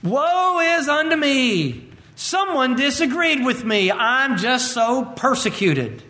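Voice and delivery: milk the pity, drawn out; mock whining. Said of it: "we begin to whine and".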